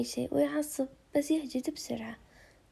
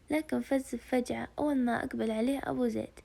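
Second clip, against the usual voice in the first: usual speaking voice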